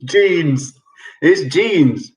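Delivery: ordinary talk, nothing marked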